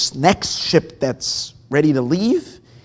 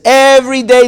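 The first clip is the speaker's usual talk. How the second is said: emphatic; 9 semitones higher, 14 dB louder than usual. Acted. Rebbe's emphatic teaching.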